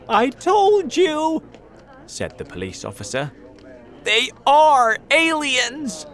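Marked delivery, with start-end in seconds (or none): 0.1-1.4 very high, loud, slow cry; drawn-out high yell from a police officer; alarmed shout. A narrator's reading. 4.0-6.0 very high, loud, drawn-out yell; slow, panicked officer's shout; alarmed shout. A narrator's reading.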